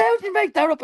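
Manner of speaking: high squeaky voice imitating the bandmate's disbelief; mock incredulous friend. Bandmate doubting his wild plan, in a high mock squeak. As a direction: pitch it way up like a baffled friend. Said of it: "He said".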